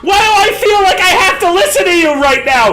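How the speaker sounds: panicked ghost. Acted. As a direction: yelp in confusion at being bound. Ghost confused and compelled.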